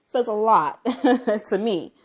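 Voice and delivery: real laughter breaking through the words; amused, incredulous laugh between words. Real, not acted. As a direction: talk through a laugh, high and loud. Laughs at the irony while talking.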